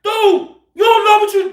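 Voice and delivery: imitating an angry man's high holler; retold heated shout. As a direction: yell it high, scolding. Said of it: "dog, he hollering".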